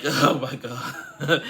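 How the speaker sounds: amused, drawn-out exclamation; laughing disbelief. Real, not acted. Laughing at the drama.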